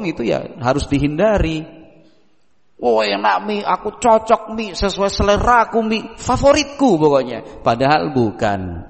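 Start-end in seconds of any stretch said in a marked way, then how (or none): 2.8-7.6 mock cheerful child, higher voice; child fibbing. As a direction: gush to please mum. Child lying to mother about food.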